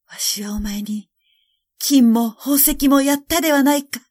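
implore, climbing to a strained high cry; desperate pleading emperor. A narrator's reading. Emperor begging his golden bird to sing, desperate plea.

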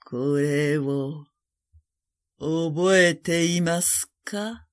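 very slow, eerie drawn-out question; eerie whispering face. A narrator's reading. Strange faces taunting the sick emperor one after another, eerie and slow. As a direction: stretch the words out like a haunting apparition. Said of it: "次々にささやきました".

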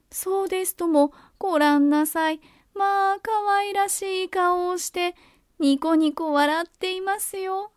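delighted grandmother. A narrator's reading. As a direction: coo over the baby. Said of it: "とおばあさんは言いました".

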